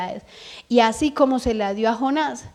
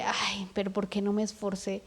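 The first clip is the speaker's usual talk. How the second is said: much softer, a lament to yourself; quiet regretful self-reproach; regretful sigh; 3 semitones lower, 10 dB quieter than usual. Retold or imitated. Regretful quote, mother's example.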